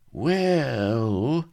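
long, high, rising stall; judicious hedging. A narrator's reading. Vladimir stalling before he explains, judiciously. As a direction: draw it out high and weigh it up. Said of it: "pursed his lips judiciously".

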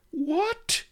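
high, shocked yelp; horrified. Acted. Melliflua horrified at Ben's plan, shocked and high. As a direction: gasp it out in horror. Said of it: "Melliflua was horrified".

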